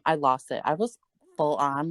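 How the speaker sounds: usual speaking voice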